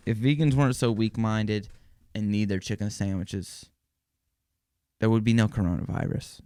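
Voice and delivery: usual speaking voice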